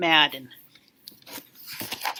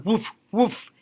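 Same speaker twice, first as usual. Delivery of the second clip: dog barks. Pretend. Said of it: "the dog says".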